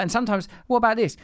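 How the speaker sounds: ordinary talk, nothing marked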